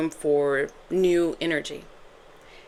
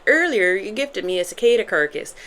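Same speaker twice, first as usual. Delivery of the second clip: amateur put-on Irish accent; playful attempt at an Irish accent. Shown as a demonstration. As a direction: try it in a Derry Girls Irish accent. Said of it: "I just kept saying that".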